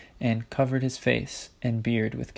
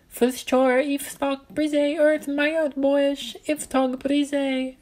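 put-on very high tiny gnome child voice; gnome child repeating; much slower than usual. A narrator's reading. Gnome child repeating Ella's words back to her, very high. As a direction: squeak the words back like a happy little gnome. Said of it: "he repeated".

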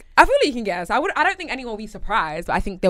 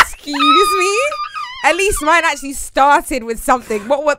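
loud laughing mock offence; laughing outrage; 5 semitones higher, 7 dB louder than usual. Real, not acted. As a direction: protest through laughter.

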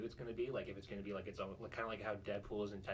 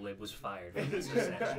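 mock announcement; 8 semitones higher, 6 dB louder than usual.

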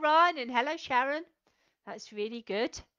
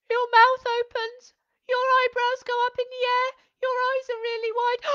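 much higher and louder, wide-eyed amazement; surprised voice; 11 semitones higher, 9 dB louder than usual. Shown as a demonstration. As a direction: sound as if the surprise just hit you. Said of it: "imagine if you've had a big surprise".

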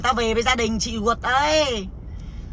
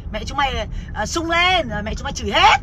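usual speaking voice

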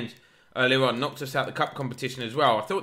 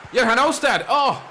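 excited shout; 11 semitones higher, 7 dB louder than usual. Real, not acted. Near miss, excited shout.

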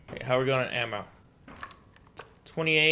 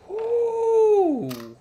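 impressed ooh; 6 dB louder than usual. Real, not acted. Impressed by new weapon.

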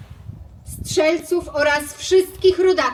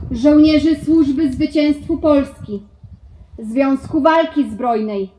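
normal talking voice

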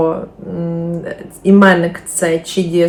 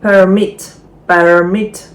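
PERmit, the noun, stressed on the first syllable; 5 dB louder than usual. Shown as a demonstration. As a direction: hit PER in permit. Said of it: "Наголос на перший склад".

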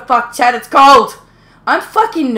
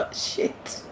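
whisper; 16 dB quieter than usual. Real, not acted. Hiding, whispering a curse.

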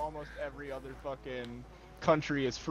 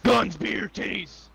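high chant, loud at the start; mock American patriot; 5 semitones higher, 5 dB louder than usual. American playing a foreigner's view of Americans, mock-patriotic shouting. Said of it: "a foreigner's fucking view of us".

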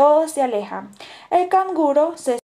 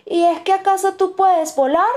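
high, louder challenging question; defiant tone; 8 semitones higher, 5 dB louder than usual. A narrator's reading. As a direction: throw it down as a dare. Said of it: "pregunta el cuervo con tono desafiante".

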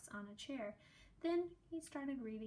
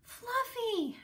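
much higher, loud surprised call; girl finding her guinea pig again, a high glad cry; happy cry; 13 semitones higher, 7 dB louder than usual. A narrator's reading. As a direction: cry out in relief. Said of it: "she cried".

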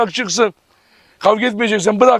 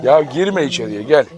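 usual speaking voice

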